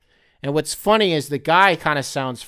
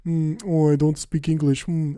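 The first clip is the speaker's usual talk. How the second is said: mock French Canadian. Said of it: "those are the motherfuckers who be like".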